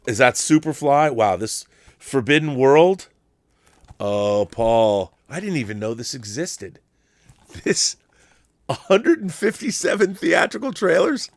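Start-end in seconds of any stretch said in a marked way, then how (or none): none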